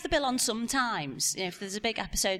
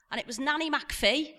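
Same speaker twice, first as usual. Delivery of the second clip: ordinary delivery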